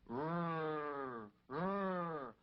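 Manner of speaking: low humming rrr noise; spaceship hum imitation. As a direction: hum like a flying saucer.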